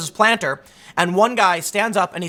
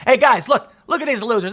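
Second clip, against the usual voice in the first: Trump jeering; 5 semitones higher, 4 dB louder than usual. Retold or imitated. Trump acted, calling to crowd.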